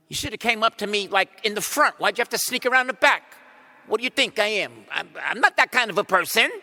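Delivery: exaggerated huffy rebuke; mock scolding. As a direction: play the offended Jesus he was not.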